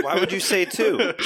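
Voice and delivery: high, laughing exasperation; laughing disbelief. Real, not acted. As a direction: laugh through the question. Friend laughing at bad roll.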